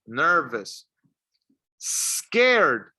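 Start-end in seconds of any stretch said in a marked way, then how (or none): 1.8-2.9 "Scared" with a long, separated opening S; careful articulation. Shown as a demonstration. Teacher models a word to repeat, careful drawn-out pronunciation. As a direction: draw out the S, then land on "Scared". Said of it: "Remember, keep your mouth closed".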